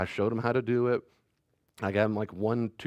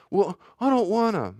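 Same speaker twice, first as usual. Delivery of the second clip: put-on high, louder childish whine; whiny kid; 11 semitones higher, 7 dB louder than usual. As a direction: sulk like a kid who won't tidy up. Child refuses to make bed.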